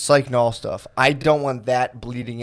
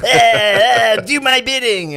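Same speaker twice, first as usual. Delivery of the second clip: high, loud hammed-up angry troll shout; mocking angry commenters; 10 semitones higher, 5 dB louder than usual. Mocking angry Reddit fans, a high hammed-up shout. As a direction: shout like a raging caveman troll. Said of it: "they're like".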